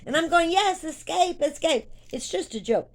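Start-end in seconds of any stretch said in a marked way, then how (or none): 0.5-1.9 high, loud mock cheer; hammed-up cry of escape; playful cheering. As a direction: join the joke at a high pitch. Joining the shouted joke, retold.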